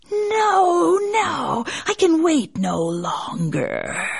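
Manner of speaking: high cry, then slow snake voice; basilisk. A narrator's reading. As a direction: refuse like an impatient serpent. Snake refuses.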